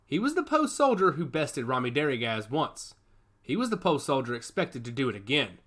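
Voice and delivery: ordinary delivery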